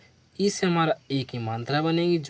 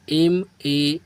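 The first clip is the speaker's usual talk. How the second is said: letter by letter, spaced out; dictating letters to a class; slow spelling; much slower than usual. Shown as a demonstration. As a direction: spell each letter slowly. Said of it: "तो क्या पढ़ेंगे?".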